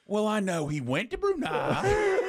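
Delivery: a friend imitating a coy evasive answer; Chris Kelly. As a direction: play dumb in an exaggerated, slow way. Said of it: "the answer is so Chris Kelly".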